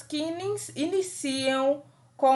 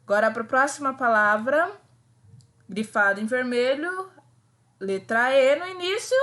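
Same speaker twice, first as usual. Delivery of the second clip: usual speaking voice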